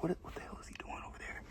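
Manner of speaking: fast whisper of staff; whispered imitation. Store staff on camera wondering about him, whispered. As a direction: mimic employees whispering, quickly. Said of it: "watching me on the camera like".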